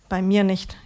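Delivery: ordinary delivery, nothing marked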